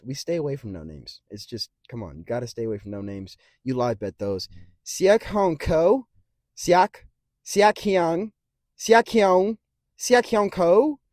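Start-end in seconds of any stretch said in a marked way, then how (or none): 4.9-11.0 slow, careful tries at "Siak Hyung Ko"; sounding out a name. Shown as a demonstration. Attempting the fighter's name aloud, slow and unsure. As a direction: sound out "Siak" syllable by syllable, rising. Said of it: "I'm gonna hope his name is that".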